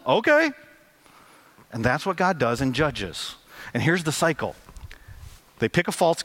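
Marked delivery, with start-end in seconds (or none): 0.1-0.5 sarcastic okay. Acted. Mock divine permission, sarcastic.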